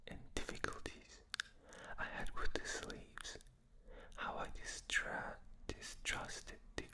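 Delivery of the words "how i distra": ordinary delivery, nothing marked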